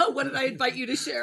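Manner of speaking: asking through real laughter; quick question, laughing as you go; amused question. Real, not acted. Host amused at being quoted.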